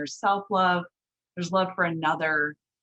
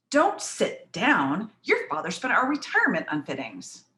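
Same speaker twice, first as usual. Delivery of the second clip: scolding parent; 2 semitones higher than usual. Acted. Parent to bride about retirement spent on fittings, scolding. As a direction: nag a bride in her costly dress.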